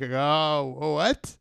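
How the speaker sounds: mock-baffled, pitch climbing into a question; hammed-up puzzled "what?"; retold bafflement. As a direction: act caught off guard, voice rising high. Retells being surprised by video demand.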